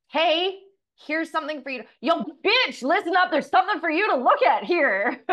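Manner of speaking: high, loud hammed-up shout; mock yell. The universe losing patience with you, loud high mock yell. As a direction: bark it like a rude drill sergeant. The universe now yelling at her.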